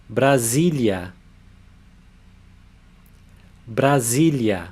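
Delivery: Brasília said slowly as brah-ZEE-lee-ah, S as Z; slow Portuguese pronunciation. Shown as a demonstration. Teacher models Brazil's capital for students slowly, S as Z. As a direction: say Brasília with a Z sound, unhurried. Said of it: "So this syllable is Z".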